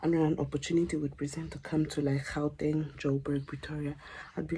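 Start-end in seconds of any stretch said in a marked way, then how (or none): none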